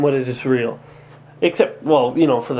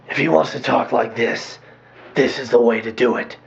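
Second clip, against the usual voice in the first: low, rough Batman growl; gravelly Batman voice; 6 semitones lower than usual. Retold or imitated. Reviewer demos Bale's gravelly Batman voice to critics. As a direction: drop into the Caped Crusader's rasp. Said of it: "I like that gravelly voice".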